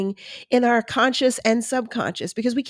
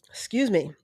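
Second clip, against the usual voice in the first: usual speaking voice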